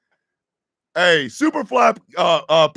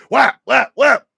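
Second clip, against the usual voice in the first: stick hit sound effect; 7 semitones higher, 7 dB louder than usual. Retold or imitated. Imitating stick hits.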